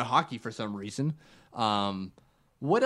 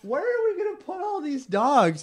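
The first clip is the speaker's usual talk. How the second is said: much higher, put-on mock wife voice; 4 dB louder than usual. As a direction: play the exasperated wife, pitched way up. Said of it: "His wife's like".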